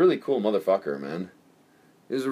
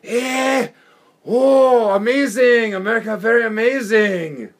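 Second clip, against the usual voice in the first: higher, louder amazed foreign voice; mock broken English awe; 9 semitones higher, 6 dB louder, slower than usual. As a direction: gush at America in broken English.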